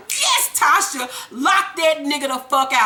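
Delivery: emphatic hype from the couch; gleeful cheering. Retold or imitated. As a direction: cheer her on like a delighted viewer. Speaker's cheering reaction to the show.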